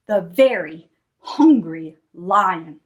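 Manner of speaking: slow, drawn-out low announcement; suspenseful storytelling. A narrator's reading. Storyteller introduces the very hungry lion, suspenseful. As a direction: stretch it out ominously.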